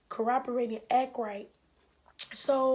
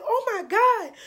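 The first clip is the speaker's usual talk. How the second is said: much higher and louder, a strained oh my God; exasperated cry; 11 semitones higher, 10 dB louder, slower than usual. Retold or imitated. Overwhelmed caller before hanging up, high strained cry. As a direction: let it burst out high in despair.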